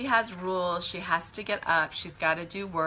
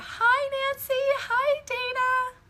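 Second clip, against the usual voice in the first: very high, drawn-out hello; singsong greeting; slower than usual. Real, not acted. Reader breaks off to greet Nancy and Dana, high singsong.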